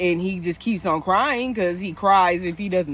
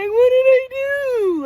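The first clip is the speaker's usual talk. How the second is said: much higher and louder shocked squeal; mock bewildered outcry; 7 dB louder than usual. To a negative person, mock shocked outcry. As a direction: play the stunned victim, all innocence. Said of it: "you gonna be like".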